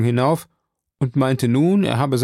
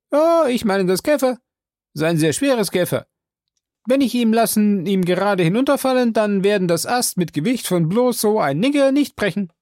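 high, loud servant voice calling down; Jupiter's voice; 8 semitones higher, 4 dB louder than usual. Acted. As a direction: pitch him well up and call out. Jupiter explains.

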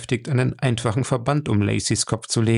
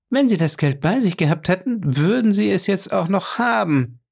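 higher-voiced Fay, lightly cool and even; Fay's composed retort in a raised voice; calm Fay; 8 semitones higher than usual. A narrator's reading. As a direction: answer him coolly, without heat. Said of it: "erwiderte Fay ruhig".

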